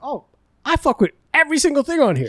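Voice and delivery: high, eager voice; excited retold self. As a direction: gush over the tiki menu. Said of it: "And I was like".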